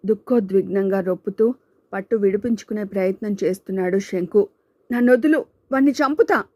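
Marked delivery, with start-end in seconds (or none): 4.9-6.4 high, loud furious yell; struggling free, screaming with rage; enraged shout. Acted. Shanku held back, raging. (పట్టు విడిపించుకుంటూ కోపంతో అరుపు)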